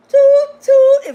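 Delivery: loud high "Toot, toot" like a horn; horn imitation. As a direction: honk it out like a horn. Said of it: "Toot your own horn".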